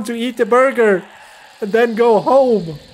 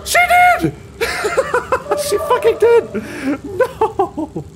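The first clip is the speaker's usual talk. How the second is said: high shrieks broken by laughter; laughing disbelief; 14 semitones higher, much slower than usual. Real, not acted. Player finds the demon took the bike, laughing shrieks. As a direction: laugh in horror as the bike is gone.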